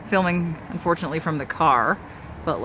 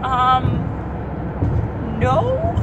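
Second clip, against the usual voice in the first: timid voice; 6 semitones higher than usual. Retold or imitated. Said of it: "in a very timid voice".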